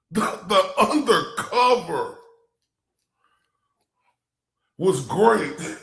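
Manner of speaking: slow, weighty stress on "undercover"; mimicking her emphatic "undercover"; mock extra emphasis. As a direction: lean hard on "undercover" and "great", drawn out. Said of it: "put some emphasis".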